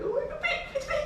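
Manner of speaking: very high, soft squeal; excited squeal. Retold or imitated. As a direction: squeak it out like a thrilled kid. Child delighted by fake movie props.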